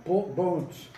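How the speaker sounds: brief British-accented demo. Shown as a demonstration. Husband finally obliges wife's request on lunch break, British accent. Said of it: "Yeah, British accent".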